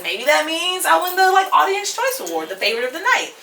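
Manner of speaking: high, eager wishful musing; dreaming of winning the award; hopeful daydream. Retold or imitated. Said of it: "So I'm like".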